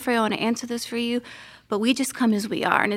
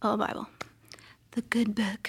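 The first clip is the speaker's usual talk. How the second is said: hushed aside; 6 dB quieter than usual. Real, not acted. Hushed aside about the Bible.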